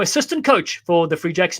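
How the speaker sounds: usual speaking voice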